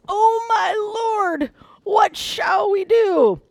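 high, slow, drawn-out wailing cry; wailing panic. Acted. Servant sees the army surrounding the city, wailing in panic. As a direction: wail it out in hammed-up despair. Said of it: "in one translation it says this".

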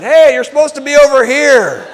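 loud, high holler; calling out. Retold or imitated. Neighbor redirects serviceman at wrong house, calling out. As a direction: shout it across the yards.

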